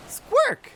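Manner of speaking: a sudden bird shriek; high squawk. Acted. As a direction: screech like a bird. Bird cry ending Ixal line.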